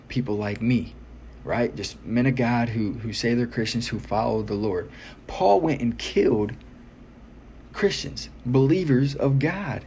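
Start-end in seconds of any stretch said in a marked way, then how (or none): 5.3-9.8 escalating comedy rant. Real, not acted. Preacher raises voice on story.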